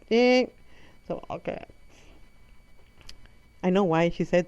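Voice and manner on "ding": high, loud "ding"; sudden high-pitched fail signal; imitated buzzer ding. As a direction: ring it out like a game-show bell. Imitating a quiz buzzer for wrong answers.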